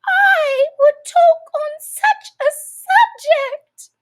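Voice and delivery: very high, loud, slow quavering shriek; trembling furious mouse. A narrator's reading. The trembling mouse rants at Alice about cats. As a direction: shriek and quiver with rage. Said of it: "trembling down to the end of his tail".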